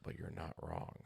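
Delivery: leaning in to whisper a secret; hushed, voiceless aside; confidential whisper. Retold or imitated. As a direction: whisper it slyly, as the retold lady. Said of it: "she leans in closer and goes".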